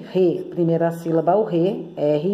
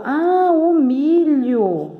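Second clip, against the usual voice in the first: high, drawn-out "ah" of discovery; delighted realization; 7 semitones higher, much slower than usual. Acted. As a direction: act like the word just clicked, stretching it out. Acts out discovering the word. (descoberta encantada, aguda e arrastada)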